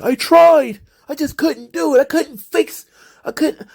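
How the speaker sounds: high, pleading mock whine; mock him as a helpless whiner; whiny excuse. Host mocking Keith Lee's excuse in a high whine. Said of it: "He's like".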